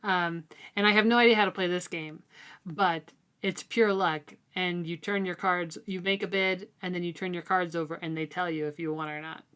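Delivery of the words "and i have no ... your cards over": normal talking voice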